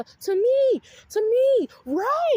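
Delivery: high, loud indignant outburst; outraged. Real, not acted. Sister outraged at brother's slap threat.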